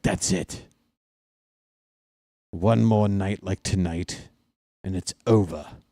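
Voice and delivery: lightly voiced neighbour in an unplaceable foreign accent; a vague put-on accent, not German. Neighbour waiting at the door threatens him, vague put-on accent. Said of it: "I don't know what that accent is".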